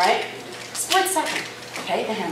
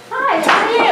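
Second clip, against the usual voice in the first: much higher, louder gushing hi; overstated greeting; 12 semitones higher, 5 dB louder than usual. Shown as a demonstration. Trainer demos an invading-your-space greeting to volunteer, over-the-top gushing. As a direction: overdo the friendliness on purpose. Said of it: "that was a little overstatement".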